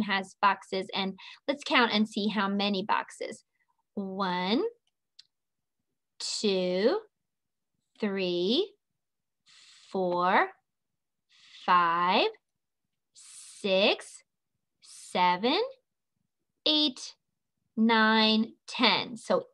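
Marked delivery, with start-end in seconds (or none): 3.9-15.6 each number drawn out with a rising tune, pauses between; counting along. Shown as a demonstration. Teacher counting boxes with the class, slow rising count. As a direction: count slowly, lifting the end of each number.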